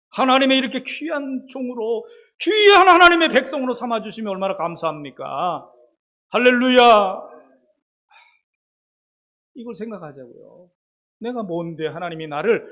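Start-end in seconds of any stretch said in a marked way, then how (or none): 0.1-7.2 higher, louder preaching up to a shouted hallelujah; building to a loud hallelujah; fiery preaching. Acted. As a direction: rouse the congregation. Preaching climax.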